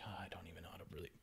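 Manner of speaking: fast, hushed unsure whisper. Retold or imitated. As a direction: rush it under your breath. Unsure about praying.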